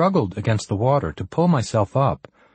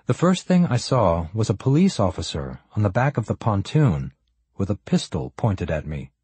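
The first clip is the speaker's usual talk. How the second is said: usual speaking voice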